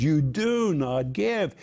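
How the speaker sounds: "do not give" slow and weighted; emphatic negative. Shown as a demonstration. Preacher explaining the Greek negative, slowly emphatic. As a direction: lean hard on "do", stretching out "do not give". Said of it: "very emphatic".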